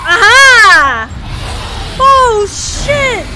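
loud, high, drawn-out outburst; alarmed shout. Real, not acted. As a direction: yell out in sudden alarm. Boss fight turns dangerous.

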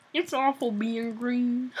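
slow, pinched puppet frog voice; slow mock Kermit voice; Kermit impression. Shown as a demonstration. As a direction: do a sad little frog puppet. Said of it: "See, that's my Kermit".

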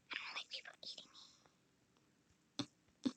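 barely whispered complaint. Real, not acted. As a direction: breathe it out in a hush.

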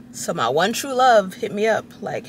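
ordinary talk

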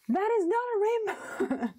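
put-on high-pitched indignant child telling-off; imitated stern scolding. Crafter retelling Piper's telling-off over pastel colours, mock stern scolding. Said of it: "stern talking to".

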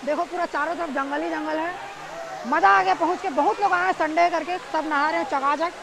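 calling out in delight over the water; excited. Real, not acted. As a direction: awestruck and pitched high at the waterfall. Thrilled on reaching the waterfall.

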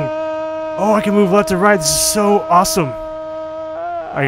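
high, loud gleeful exclaiming; excited delight. Real, not acted. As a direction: whoop with joy at the ride. Delighted by sliding in game.

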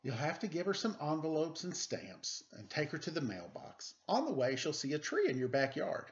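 put-on lively read-aloud for children; storybook reading. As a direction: read it like a bedtime picture book. Reads picture book aloud.

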